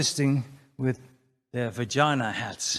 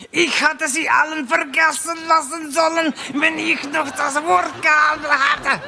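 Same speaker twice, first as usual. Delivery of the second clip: mock German rant; 13 semitones higher, 6 dB louder than usual.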